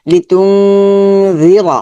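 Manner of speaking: তুংজি chanted slowly with long nasal hum; Quran recitation. Shown as a demonstration. Teacher demonstrating the heavy র, slow chant. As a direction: stretch তুংজি র in measured tajweed. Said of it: "রর উপর জবর আছে".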